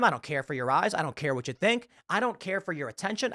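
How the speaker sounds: normal talking voice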